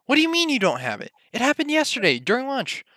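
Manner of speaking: fast, high-pitched acted disbelief; agitated protest. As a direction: rush it out high, as if caught off guard. Acted panic over lost fortune.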